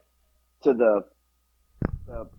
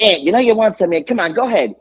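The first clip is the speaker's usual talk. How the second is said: higher, louder New York Italian deli lady; New York Italian accent; 6 semitones higher, 3 dB louder than usual. Retold or imitated. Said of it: "like, real New York Italian".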